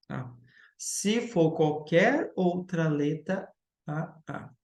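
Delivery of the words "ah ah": normal talking voice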